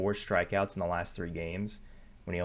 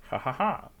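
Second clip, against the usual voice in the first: higher-pitched "ha ha ha"; short laugh; 6 semitones higher than usual. Real, not acted. Host amused after checking DraftKings, short laugh. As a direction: let out a brief, higher laugh.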